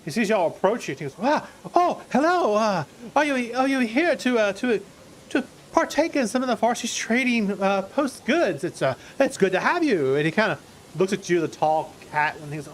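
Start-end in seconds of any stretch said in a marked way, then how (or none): 1.2-10.1 higher, eager friendly villager voice; cheerful trader Cotter. Acted. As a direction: greet the strangers like a delighted rustic trader.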